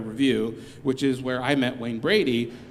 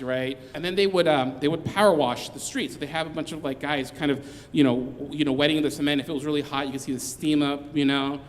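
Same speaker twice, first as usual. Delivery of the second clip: normal talking voice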